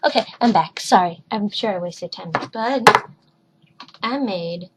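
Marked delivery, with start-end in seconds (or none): none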